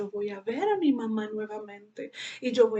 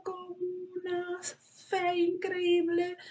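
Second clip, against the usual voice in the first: tearful; 6 semitones higher, 3 dB quieter, slower than usual. Real, not acted. Testimony of faith, voice cracks.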